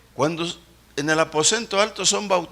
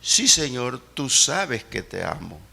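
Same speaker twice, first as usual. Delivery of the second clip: saddened voice; 6 semitones lower, slower than usual. Retold or imitated. Pedro, grieved, answers the Lord in a sad tone. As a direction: lower and slow, heavy with sorrow. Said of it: "se entristeció en gran manera y cambió hasta el tono de voz".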